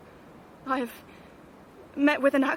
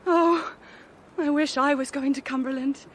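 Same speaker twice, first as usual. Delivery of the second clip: eager longing; 3 semitones higher, 4 dB louder than usual. Acted. Woman reacts to place name.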